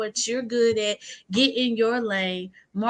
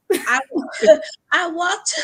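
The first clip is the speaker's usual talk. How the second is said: laughing; 8 semitones higher, 4 dB louder, slower than usual. Real, not acted. Laughs while starting court story.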